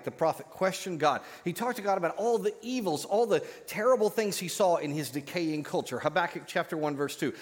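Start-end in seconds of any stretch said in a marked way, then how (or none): none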